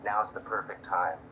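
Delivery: loud, low and showy to be overheard; loud announcement. Acted. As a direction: talk it up loudly for strangers to hear. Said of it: "I'll loudly say".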